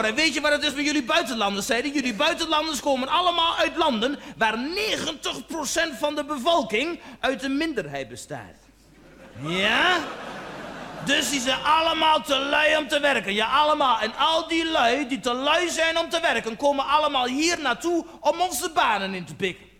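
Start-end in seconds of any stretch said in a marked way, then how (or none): 0.0-1.7 higher-pitched mock tirade; ranting tirade. Janmaat going on at the man about foreigners, ranting. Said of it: "hij ging maar door". 1.9-19.7 higher-pitched, relentless mock rant; hammed-up xenophobic harangue; ranting tirade. Janmaat berating foreigners on and on, ranting. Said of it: "hij ging maar door".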